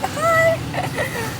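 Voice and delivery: very high, small mock hello; acting out own reply. Vlogger retells her reply to valet, high mock hello. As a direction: squeak a retold greeting. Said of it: "I was like".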